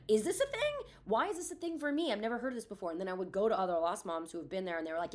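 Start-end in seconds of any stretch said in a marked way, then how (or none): 0.1-2.9 much higher, wondering questions; as if discovering it in disbelief; puzzled surprise. Retold or imitated. Grieving mom retells her first-year confusion, surprised. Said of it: "And I was like".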